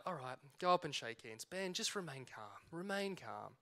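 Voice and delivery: soft, low pep talk to yourself; hushed self-talk. Retold or imitated. Referee talking himself down before shaking hands, hushed self-talk. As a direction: mutter it under your breath, softly, as if coaching yourself.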